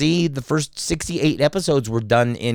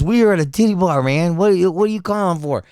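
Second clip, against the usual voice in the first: raised-pitch retold phone talk; animated phone chat; 7 semitones higher than usual. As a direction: chat eagerly to a buddy on the phone. Acting out friend's phone call.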